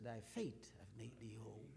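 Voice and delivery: hushed, whispered words of Jesus; whispered blessing. Acted. Jesus speaking to the healed blind man, a hushed whisper.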